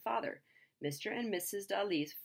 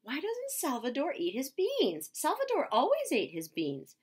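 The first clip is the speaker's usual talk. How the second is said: mother's voice; 9 semitones higher, 4 dB louder than usual. Retold or imitated. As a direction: play the worried mom, pitched up. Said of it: "his mother is saying".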